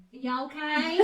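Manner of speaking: imitating a Southern drawl, high and loud with a laugh. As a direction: drawl it like a kid doing a Southern accent. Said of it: "she would go like this to you in this weird accent".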